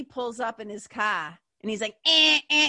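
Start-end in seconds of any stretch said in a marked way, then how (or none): 2.1-2.7 loud imitated car horn honks. Buddy honking outside the flooded town house, loud honks. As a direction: honk like a buddy leaning on the horn. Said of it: "he's, like, laying on the horn".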